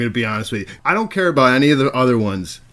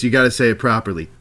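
ordinary delivery

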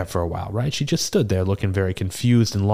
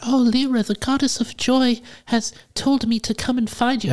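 put-on satyr girl voice. Bard voicing the lost satyr girl to the tavern keeper, very high. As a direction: lift way up into a young satyr's plea. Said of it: "that's not really what she sounded like".

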